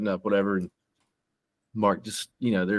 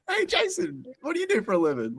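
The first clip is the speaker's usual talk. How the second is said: very high mock question; mock uncle. Retelling the uncle's question, very high mock voice. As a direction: lampoon the naive uncle. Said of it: "It's being like".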